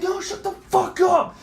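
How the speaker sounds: very high, drawn-out shout; slow, high re-enacted yell; retold going off. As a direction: shut someone down with a retold scream. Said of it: "And I'm fucking going off".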